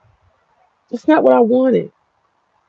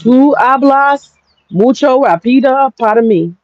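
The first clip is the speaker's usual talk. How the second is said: put-on slow, careful Spanish in an American accent; asking locals in American-accented Spanish to slow down; 4 semitones higher, slower than usual. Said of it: "I say".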